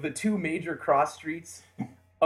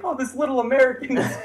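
higher, louder, laughing as the metalheads; psyched metalhead; 11 semitones higher, 6 dB louder than usual. Retold or imitated. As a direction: grin through it, delighted to adopt the kid. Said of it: "They're like".

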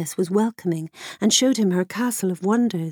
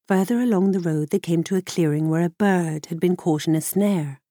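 plain reading voice

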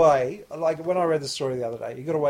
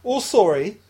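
put-on English accent; 3 semitones higher, 11 dB louder than usual. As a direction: sneer it like the British teen. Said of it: "You actually said it better then".

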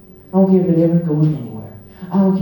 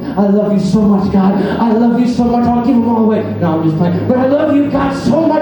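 higher and louder, swelling joy; joyful outburst of love to God; rising ecstatic praise; 8 semitones higher, 3 dB louder than usual. Real, not acted.

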